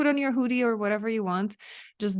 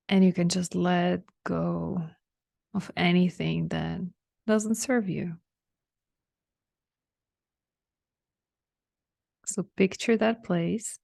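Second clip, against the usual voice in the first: unhurried relaxation guide with long pauses; spacious, measured meditation lead; guided meditation. Real, not acted. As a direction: guide them gently inward, leaving room to breathe. Leads listeners through a relaxation meditation.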